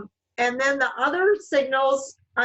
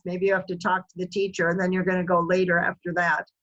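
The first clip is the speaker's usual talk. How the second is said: ordinary talk, nothing marked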